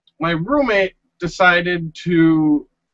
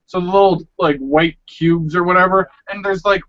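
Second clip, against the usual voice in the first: ordinary talk, nothing marked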